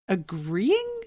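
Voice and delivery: high-pitched disbelief; incredulous. Real, not acted. Podcaster baffled at a character misreading the doctor, incredulous.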